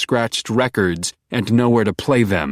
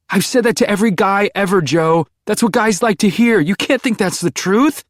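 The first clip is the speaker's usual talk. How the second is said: much higher, louder desperate plea; Beck pleading; 12 semitones higher, 4 dB louder than usual. A narrator's reading. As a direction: beg him to stop. Beck interjecting.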